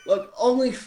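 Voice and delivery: usual speaking voice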